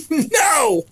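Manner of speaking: put-on high loud childish scream. A frightened boy refusing the preacher, loud high scream. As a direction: shriek it like a scared boy. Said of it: "I screamed real loud".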